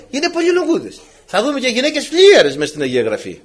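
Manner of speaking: usual speaking voice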